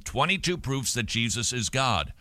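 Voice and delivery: normal talking voice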